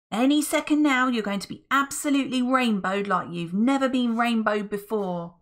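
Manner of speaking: high, loud and cocky; Friday, sure of himself. Acted. Friday promising the cave a rainbow, high and cocky. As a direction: brag it, sure the rainbow's coming.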